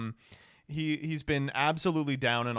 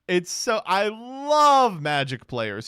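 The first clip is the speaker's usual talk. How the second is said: gushing; 5 semitones higher, 5 dB louder, much slower than usual. Real, not acted. Gushing over Magic players' logic.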